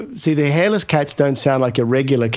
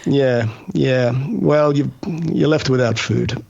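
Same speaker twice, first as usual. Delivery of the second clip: indifferent cat; slower than usual. Retold or imitated. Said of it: "they'll look at you and go".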